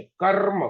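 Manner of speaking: कर्म with r before m; कर्म said as kar-m, r sounded before the m; कर्म, r ahead of m; say karm. Shown as a demonstration. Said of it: "पहले रा का उच्चारण होता है".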